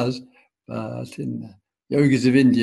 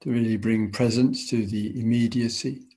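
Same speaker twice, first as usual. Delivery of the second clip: guided meditation. Acted. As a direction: ease them into stillness, unhurried.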